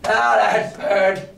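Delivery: lightly voiced jolly pirate, slowed on the parrot; a friendly old sea cook, hammed up a little. Silver welcoming the boy, lightly voiced pirate.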